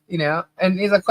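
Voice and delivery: normal talking voice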